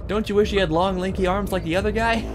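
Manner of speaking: loud, high-pitched gloating; playful taunt. Pretend. Player mocking the enemy who can't reach, high loud taunt. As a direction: tease them in a high, loud voice.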